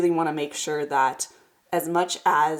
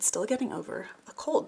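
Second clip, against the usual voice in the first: whispering; 3 semitones higher, 7 dB quieter than usual. Real, not acted. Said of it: "I sound a little congested".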